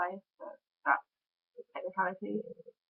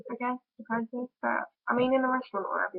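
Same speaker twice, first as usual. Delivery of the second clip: ordinary talk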